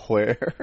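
WHERE with an audible H before the W; imitated aspirated wh. Host mimicking a friend's odd pronunciation, aspirated wh. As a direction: breathe a "hw" onto the front of WHERE. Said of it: "now pronouncing things like".

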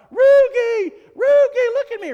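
high, loud ballpark fan yell; excited fan shouting across the stadium. Acted. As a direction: holler for a star player's attention. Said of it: "you can yell out".

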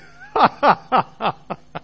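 loud burst of laughter, imitating Dad; father's hearty laugh. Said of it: "oh, he started laughing".

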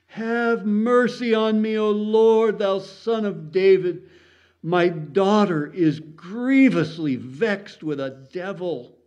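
high, loud, drawn-out plea; slow, ringing desperate appeal; crying out. A narrator's reading. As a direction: cry out for mercy, pitched high. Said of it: "cried unto him".

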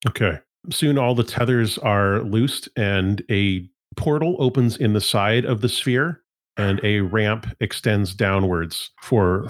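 ordinary talk

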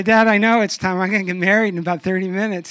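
loud, fast mock protest; hammed-up impatient son; exasperated retort. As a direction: blurt it out at dad, exasperated.

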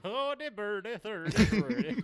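high, sing-song fake Swedish; mock Swedish accent. Podcaster hamming up his botched Russian, mock Swedish accent. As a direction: put on a silly, high-pitched Swedish accent. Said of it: "It sounded like Swedish trying to do a Russian accent".

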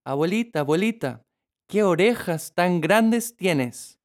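put-on amazed little girl. A narrator's reading. Girl asking the questions. (niña asombrada)

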